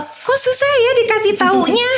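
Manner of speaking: high, put-on exasperated voice; mock despair at a stubborn pupil; exasperated thought. Teacher facing a hard-to-teach child, mock exasperation.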